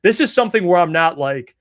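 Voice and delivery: ordinary talk, nothing marked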